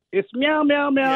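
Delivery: high mock meows that won't quit; cats meowing. Imitating the card meowing after it was closed, high mock meows. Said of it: "this thing is still, like, rocking".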